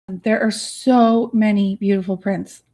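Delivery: ordinary talk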